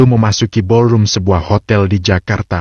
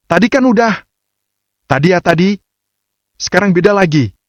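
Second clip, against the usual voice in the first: annoyed exclamation; 9 semitones higher than usual. A narrator's reading. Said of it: "seru Mentari jengkel".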